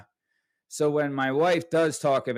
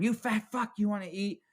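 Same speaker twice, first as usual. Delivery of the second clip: mocking dad taunt; 8 semitones higher than usual. Third hit, dad taunting.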